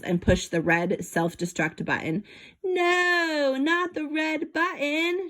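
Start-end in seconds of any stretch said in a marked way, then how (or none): 2.6-5.3 high, panicked shriek. Acted. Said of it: "shrieked Professor Van Alt".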